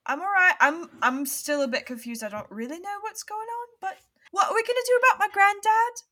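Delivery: put-on a scared kid trying to sound brave; anxious girl's voice.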